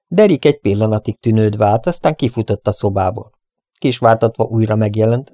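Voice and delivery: usual speaking voice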